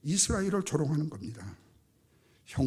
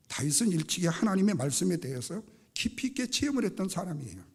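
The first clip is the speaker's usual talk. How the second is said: usual speaking voice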